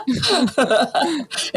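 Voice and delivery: emotional laugh near crying; laugh through tears. Real, not acted. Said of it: "You're allowed to cry".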